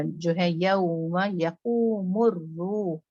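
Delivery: slow यौम यकूमुर रूह, heavy मुर; drawn-out Quranic reading; slow recitation. Shown as a demonstration. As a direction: hold the heaviness on मुर. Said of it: "मुर का बहुत अच्छा ख्याल रखिएगा".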